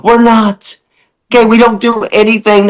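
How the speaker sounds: high, heated, incredulous tirade; exasperated rant. Real, not acted. Host ranting at lion-argument commenters, high and exasperated. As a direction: vent at the absurd argument.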